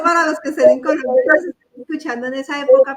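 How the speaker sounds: usual speaking voice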